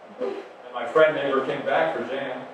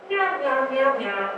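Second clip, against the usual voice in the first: high, squeaky meows; four high cat meows; cat meowing; 9 semitones higher than usual. Retold or imitated. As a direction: mew it like a small cat. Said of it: "just once he said".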